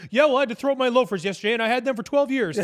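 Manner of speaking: a hammed-up random answer; mock Trudeau non-answer. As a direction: parody a rambling evasive reply. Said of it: "He just says something fully random".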